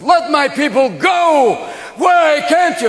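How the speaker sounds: high, slow, drawn-out shout; loud, hammed-up demand at high pitch; commanding Moses. Moses demands freedom from Pharaoh, commanding shout. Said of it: "That time Moses said".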